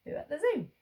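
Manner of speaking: high playful "Ooh at the zoo" cue; teacher giving a sound hint. Shown as a demonstration. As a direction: bounce it up high like a rhyme. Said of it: "Ooh, ooh".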